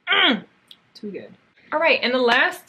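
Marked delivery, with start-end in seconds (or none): 0.9-1.3 softer, lower too good; quiet. Real, not acted. Vlogger repeating her praise, hushed and low. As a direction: murmur it to yourself.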